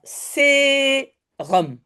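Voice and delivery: "sérum" chanted as sé, pause, rum; "sérum" drawn out syllable by syllable; split into syllables. Shown as a demonstration. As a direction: hold the first syllable of "sérum", then drop in "rum". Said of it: "comme si je chante le mot".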